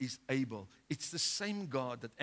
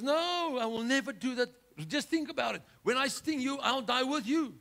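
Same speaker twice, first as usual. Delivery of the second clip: higher, coaxing scorpion voice; sly scorpion; 9 semitones higher, 5 dB louder than usual. Acted. Scorpion persuading the frog to carry him, higher coaxing voice. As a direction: sweet-talk the frog into it. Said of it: "The scorpion says".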